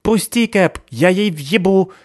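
put-on high, loud accented fury; straining accent. Сыкиль рвётся из рук Кэпа, high loud furious. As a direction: fight against his hold. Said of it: "рвётся из моих рук Сыкиль".

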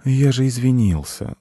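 mild protest. A narrator's reading. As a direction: plead that the apology was made. Cat defends his apology. (обиженное вежливое оправдание)

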